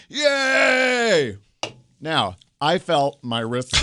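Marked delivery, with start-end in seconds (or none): 0.1-1.4 high mock cheering shout; crowd cheer. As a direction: cheer like a whole room siding against him. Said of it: "the room literally went".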